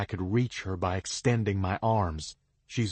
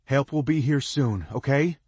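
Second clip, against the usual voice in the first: raised, higher call; calling reassurance down the cliff; louder, reassuring; 6 semitones higher, 5 dB louder than usual. A narrator's reading. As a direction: project comfort down to her. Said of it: "I say in a louder voice".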